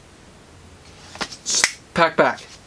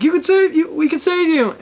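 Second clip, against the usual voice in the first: high-pitched, acted desperate cry; desperate urging; 11 semitones higher than usual. Batman insisting they can save him, desperate. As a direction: plead it high, in mock desperation.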